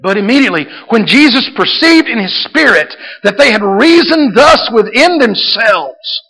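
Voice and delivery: high, loud, fervent preaching; full-force preaching. Acted. Pastor urging the church to expect a miracle, fervent preaching.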